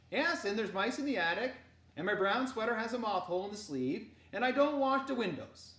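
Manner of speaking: loud, rising mock confession; teasing uncle. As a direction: play along, joking. Henrik teasing back.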